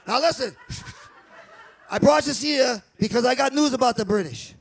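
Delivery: put-on loud, brash Boston accent; rowdy Boston accent, rallying the guys; Boston townie accent. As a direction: bark it like a Boston townie calling a meeting. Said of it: "this is what I picture it sound like".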